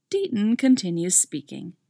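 ordinary delivery, nothing marked

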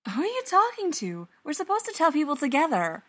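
high, fast call from afar; distant yell. A narrator's reading. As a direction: call out quickly from another room. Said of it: "Patrick hears Bob yell".

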